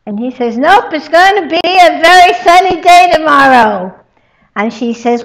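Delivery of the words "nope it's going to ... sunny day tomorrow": loud, high, dead-set insisting; stubborn insisting. Acted. First man arguing with his wife about the weather, loud and stubborn. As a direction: plant your feet and insist it'll be sunny.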